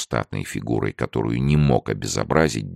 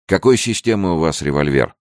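politely cool question, pitched up; coldly polite; 6 semitones higher, 3 dB louder than usual. A narrator's reading. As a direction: inquire with chilly courtesy. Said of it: "холодно-вежливым тоном осведомился он". (холодно-вежливо)

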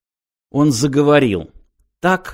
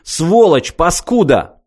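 high, louder cursing; acted yell; 6 semitones higher, 4 dB louder, slower than usual. A narrator's reading. Vanished lad's friend curses Martin, outraged yell. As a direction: hurl each insult at a raised pitch. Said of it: "завопил один из дружков исчезнувшего".

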